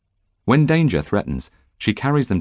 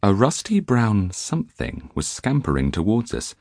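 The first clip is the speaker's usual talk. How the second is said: usual speaking voice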